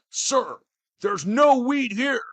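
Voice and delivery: high, loud, slow worried underling; a nervous soldier reporting to his commander; hammed-up flustered agent; mock agent report. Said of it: "agent reporting to boss".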